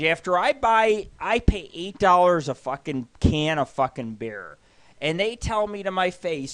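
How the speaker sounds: ordinary talk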